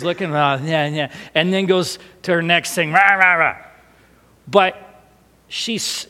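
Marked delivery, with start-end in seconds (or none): 2.9-3.6 playful roaring, loud; mimicked rampaging child. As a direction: imitate a little whirlwind roaring through. Imitating the hurricane child rampaging.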